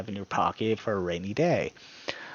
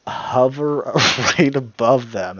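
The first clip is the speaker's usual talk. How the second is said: louder, laughing through the words; amused laughter; 2 semitones higher, 7 dB louder than usual. Real, not acted. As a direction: let a laugh break into the phrase. Amused by imagined ambush.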